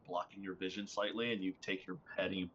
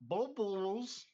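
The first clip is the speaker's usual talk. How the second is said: underwater voice; 11 semitones higher than usual. Retold or imitated. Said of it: "'Cause you're currently talking underwater".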